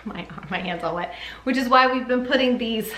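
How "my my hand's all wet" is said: hushed, breathy aside to herself; quick whispered aside with a laugh. Real, not acted. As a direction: drop to a whisper mid-sentence.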